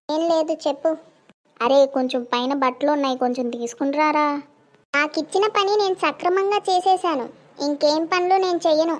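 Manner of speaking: ordinary talk, nothing marked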